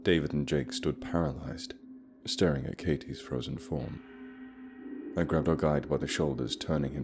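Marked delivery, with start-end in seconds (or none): none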